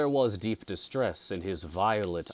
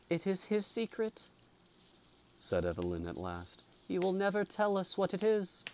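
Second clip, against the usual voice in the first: put-on young woman; 12 semitones higher than usual. A narrator's reading. Frightened girl's voice.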